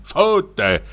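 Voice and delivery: plain reading voice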